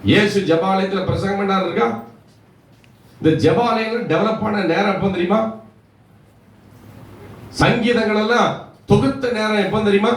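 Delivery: high-pitched rhetorical questioning; preacher challenging the listeners; impassioned preaching. Real, not acted.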